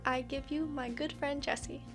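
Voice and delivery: ordinary talk, nothing marked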